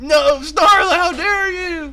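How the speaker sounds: high, very loud melodramatic shout; hammy hero shrieking in outrage; horrified outcry. Acted. Companion's attack hits his own princess, horrified high shout.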